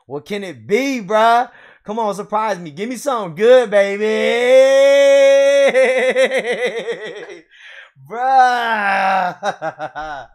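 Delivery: loud, high, rising hype into a laughing yell; hyped shouting. Real, not acted. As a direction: build up the suspense then explode. Waiting for the hit, hyped.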